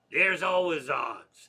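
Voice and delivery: put-on loud, slow drunken heckle; stretched-out boozy holler; drunken guy. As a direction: imitate a drunk butting in loudly. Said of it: "another drunken guy who had nothing to do".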